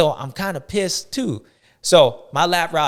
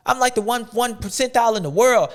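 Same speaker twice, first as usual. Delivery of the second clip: higher, boastful impression of a backmarker; mock proud rider; 8 semitones higher, 3 dB louder than usual. A lapped rider brags about his ranking, mock boastful. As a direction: puff up like a lapped rider bragging. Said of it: "you like".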